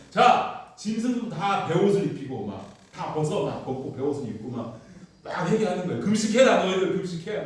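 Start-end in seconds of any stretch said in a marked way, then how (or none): 0.1-3.5 loud, high royal command; king issuing decree. Acted. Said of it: "칭령을 내린다". 6.0-7.4 loud, high royal command; king issuing decree. Acted. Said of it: "금식하게 하는 거예요".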